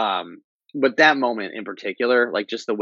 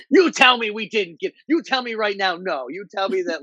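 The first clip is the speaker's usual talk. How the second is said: retold high-pitched urgent demand; insistent demand; 10 semitones higher than usual. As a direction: press him hard for the answer. Demanding news from teasing partner.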